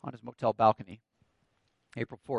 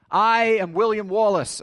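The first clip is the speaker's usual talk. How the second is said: loud, high declaration to an army; William Wallace; 5 semitones higher, 5 dB louder than usual. Acted. Wallace addresses his countrymen before war, loud proclamation. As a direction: proclaim it like a battle leader, in your own accent. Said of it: "he says this".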